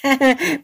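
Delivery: short high-pitched cheeky giggle; acted mischievous little laugh; taunting laugh. A narrator's reading. Boy teasing the woman chasing him, taunting laugh.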